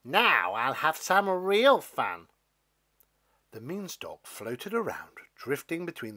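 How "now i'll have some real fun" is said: slow gloating. Acted. Stalk gloats.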